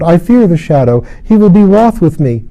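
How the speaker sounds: dread. A narrator's reading. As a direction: plead in terror of him. Lilith dreading the shadow.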